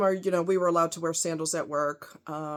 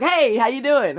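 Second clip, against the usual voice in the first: loud, high, overeager hey; mock-eager coworker greeting; 6 semitones higher, 9 dB louder than usual. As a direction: pounce on her with a bright loud hello. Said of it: "He would pounce on me".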